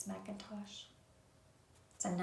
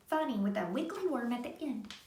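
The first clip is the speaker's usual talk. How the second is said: ordinary delivery, nothing marked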